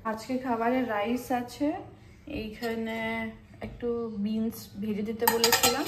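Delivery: ordinary talk, nothing marked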